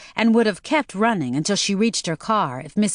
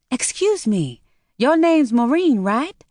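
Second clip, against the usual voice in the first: put-on Chinese accent; 7 semitones higher, 3 dB louder than usual. A narrator's reading. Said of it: "slight Chinese accent that contained a hint of a southern drawl".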